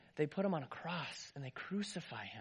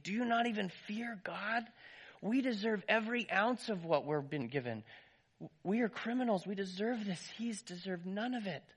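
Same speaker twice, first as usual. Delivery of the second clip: yelling; 6 semitones higher than usual. Acted. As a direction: rebuke him sharply. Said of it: "he yelled at the first man".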